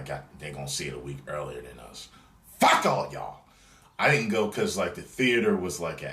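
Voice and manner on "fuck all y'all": louder, higher playful curse; mock angry outburst. Real, not acted. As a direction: snap it at the lucky fans. Mock outburst at other fans.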